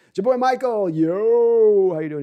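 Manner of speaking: a loud mock mafia accent; mock mob accent, announced loudly; Godfather accent. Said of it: "Godfather ... as an accent".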